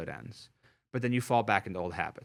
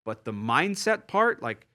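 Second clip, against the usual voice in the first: ordinary talk, nothing marked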